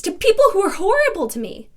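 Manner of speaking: high, loud, rushed indignation; indignant outburst. Acted. Woman recalling mistreatment to a reporter, loudly indignant. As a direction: let the hurt burst out fast and loud.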